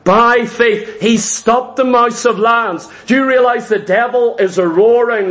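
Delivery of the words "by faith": ordinary talk, nothing marked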